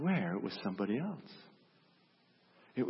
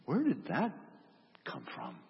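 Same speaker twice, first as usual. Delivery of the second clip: flabbergasted. Acted. Frozen onlookers ask each other about him, flabbergasted. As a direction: trail off in stunned disbelief after a pause. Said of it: "disbelieving, just flabbergasted".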